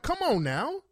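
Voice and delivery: high mock coaxing; imitating the woman. Woman leaning on the officer for special treatment, mock coaxing. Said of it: "She's like".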